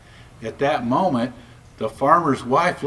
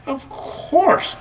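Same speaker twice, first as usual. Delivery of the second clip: high, purring cat voice; 10 semitones higher than usual. A narrator's reading. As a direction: purr it out like a smug cat. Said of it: "purred the cat".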